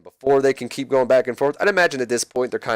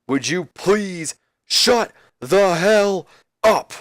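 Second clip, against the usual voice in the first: slow, high put-on Rock voice; Rock catchphrase; 7 semitones higher, much slower than usual. The Rock shutting down DDP, slow and high. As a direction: lean into every word like The Rock.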